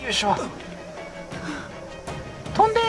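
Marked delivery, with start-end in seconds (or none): 2.5-2.8 desperate shout. Real, not acted. Urging character to jump.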